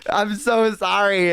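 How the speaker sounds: high, drawn-out whiny plea; hammed-up begging, stretching each sorry; pleading apology. Customer begging fed-up florist's pardon, hammed-up high whine.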